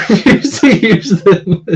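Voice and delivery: high, slow words breaking into laughter; real giggling through the words; laughing. Real, not acted. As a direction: crack up reading out a joke. Cracks up reading chat joke.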